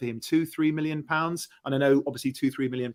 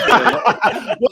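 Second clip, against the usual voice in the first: laughing; 8 semitones higher, 9 dB louder than usual. Real, not acted. Laughs at host's joke.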